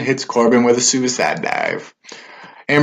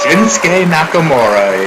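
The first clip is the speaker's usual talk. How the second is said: announcer voice; 6 semitones higher, 4 dB louder than usual. Acted. Ring entrance announced.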